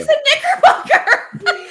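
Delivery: high, loud, drawn-out echo through laughter; mock-incredulous echo. Echoes husband's answer in disbelief.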